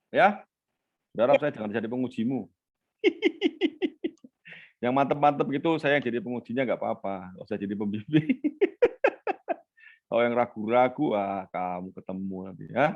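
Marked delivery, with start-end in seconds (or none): 3.0-4.1 high laugh. Real, not acted. Lecturer laughing at own joke. 8.3-9.5 high laugh. Real, not acted. Lecturer laughing at own joke.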